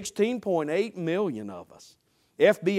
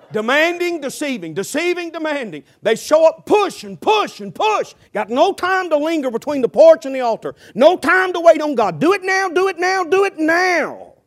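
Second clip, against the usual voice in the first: high, loud, pounding preacher's cry; preaching shout; 8 semitones higher, 6 dB louder than usual. Real, not acted. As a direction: drive it home full force.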